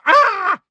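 loud, very high shriek; piercing cry of shock; startled scream. A narrator's reading. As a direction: scream in sudden fright. Character cut off by sudden cracks.

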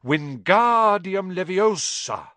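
loud, slow and precise; confident spell. A narrator's reading. Hermione casting the spell to show Ron, slow and precise. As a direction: cast it cleanly and proudly. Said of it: "and said".

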